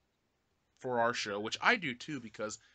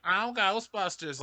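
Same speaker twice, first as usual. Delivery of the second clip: slow, louder, higher receptionist impression; Annie Potts; 6 semitones higher, 5 dB louder, slower than usual. Host imitating the Ghostbusters receptionist answering, slow mock drawl. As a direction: drawl the greeting out, pitched up. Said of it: "do a voice, the lady from Ghostbusters".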